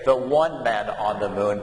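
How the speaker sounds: the one man on the moon, given as the wrong way to say it; teacher modelling the incorrect form, the one man; wrong example. Shown as a demonstration. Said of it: "we don't say".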